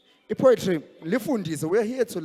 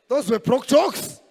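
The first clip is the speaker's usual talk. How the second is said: mock posh voice; 7 semitones higher, 7 dB louder, slower than usual. MC punchline, high loud put-on.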